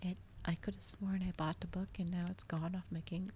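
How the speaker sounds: normal talking voice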